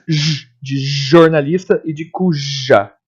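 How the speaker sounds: "J" as a long zh, held in "jornalista" and "cuja"; buzzed J sound. Shown as a demonstration. Teacher contrasting J with G, "J" sounded as a drawn-out zh. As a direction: stretch every j in "jornalista" and "cuja". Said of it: "O J é o que faz".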